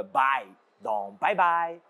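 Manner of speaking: bye said slowly, like English "bye"; slow, careful bye bye; pronunciation demo. Shown as a demonstration. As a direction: model bye dans bye bye slowly and clearly. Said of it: "Bai se prononce comme".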